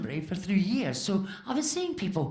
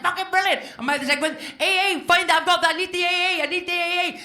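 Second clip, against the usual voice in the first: high, loud, thrilled yelling; mock overjoyed cry for help; excited shout; 9 semitones higher, 5 dB louder than usual. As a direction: yell it like you just got rescued. Thrilled the AA were there.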